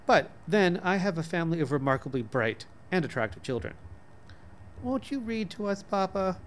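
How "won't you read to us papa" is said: put-on softer, higher child's plea; a small daughter coaxing, lightly played; lightly voiced little girl. Daughter asking father to read, soft childlike plea. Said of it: "asked Kitty as I looked up".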